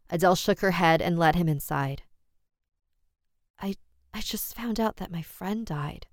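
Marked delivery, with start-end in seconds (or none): 3.6-6.1 soft, higher, halting shock; barely getting the words out; stunned and shaken. Acted. Adele in shock.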